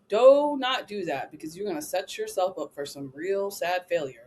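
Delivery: ordinary delivery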